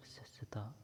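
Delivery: soft whispered eulogy. A narrator's reading. Buddha honours his dead last disciple, whispered. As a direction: breathe it out, barely voiced.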